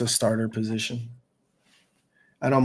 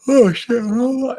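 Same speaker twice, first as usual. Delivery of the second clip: mock surprise; 11 semitones higher, 6 dB louder, much slower than usual. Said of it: "they're just like".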